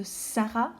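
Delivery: "Sarah" with a long hissed "Sss" at the start; "Sarah", first consonant drawn out; exaggerated first sound. Shown as a demonstration. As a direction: hold the opening S of "Sarah". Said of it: "Vous entendez le s".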